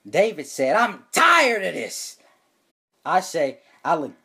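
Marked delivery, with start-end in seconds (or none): 0.8-2.2 slow, high, loud fed-up whine; hammed-up teen frustration; mock exasperation. Student storms off in roleplay.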